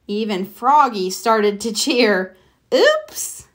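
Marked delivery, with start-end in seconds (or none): 2.7-3.5 very high squeaky oops; caught-out oops. Acted. Froggy realises he cheered for his rival, very high. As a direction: catch yourself too late.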